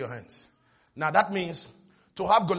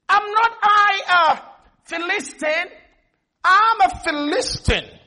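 high, slow, ringing declaration; Goliath's shouted taunt; 11 semitones higher, 6 dB louder, much slower than usual. Acted. Goliath declaring himself a Philistine to Israel, high drawn-out shout. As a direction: proclaim it loud, stretching the words. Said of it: "He shouted unto the armies".

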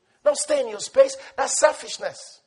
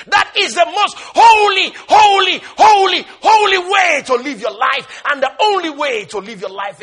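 loud, higher, rolling sermon cry; full-force preaching; 5 semitones higher, 9 dB louder than usual. Acted. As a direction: preach it out to the back pew. Preaching climax.

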